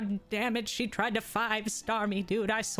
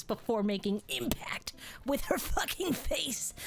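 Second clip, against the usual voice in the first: whisper. Pretend. Mock slap wind-up story.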